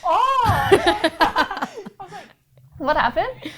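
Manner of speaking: laughing through the retelling. Real, not acted. Laughing as she tells teammates of the car incident. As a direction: giggle the words out as you recall it. Retelling her shocked reaction.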